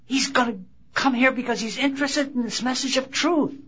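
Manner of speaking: mock the crowd fawning over a celebrity; imitating admirers gushing softly; awed gushing crowd. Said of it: "everybody say".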